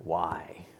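put-on child's soft whisper; small whispered why. Toddler asking her dad why, whispered. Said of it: "She asks".

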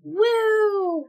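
high whooping cheer; excited whoop. Real, not acted. Cheer at initiative roll.

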